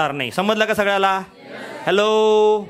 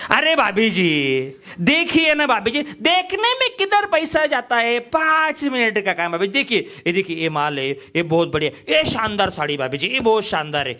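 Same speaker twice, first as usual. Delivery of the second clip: coaxing hawker. Retold or imitated. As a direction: wheedle her to come look.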